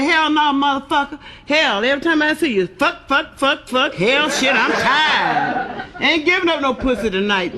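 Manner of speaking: high-pitched exasperated wife; irritated refusal. Acted. Tired wife turns down her husband, exasperated.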